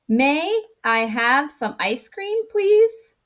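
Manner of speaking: slow question, jumping high on "please"; rising question. Shown as a demonstration. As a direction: lift "please" sharply at the end, asking. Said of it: "your voice will go up at the end".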